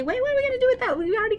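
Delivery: high mock-puzzled question. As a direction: pretend to be stumped.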